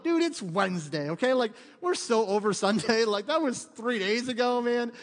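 loud, high, laughing mock whine of the crowd; playing the bored congregation, dude-style; mock bored teen. Pastor mimics congregation brushing off Sunday, loud mocking whine.

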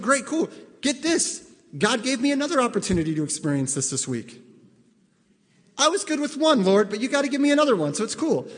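hammed-up eager imagined listener; mock eager. Imagined listener boasting to God.

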